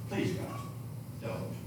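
barely audible, pleading whisper; Amos' faint plea to spare his people. Acted. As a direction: beg God in a breath. Amos pleads with God.

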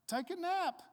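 high-pitched imitation of a reassuring woman; Trina. Imitating her telling him to rest, high and coaxing. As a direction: lift the voice high to coax. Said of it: "she'll tell me stuff like".

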